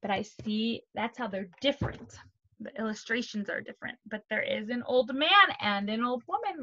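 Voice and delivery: usual speaking voice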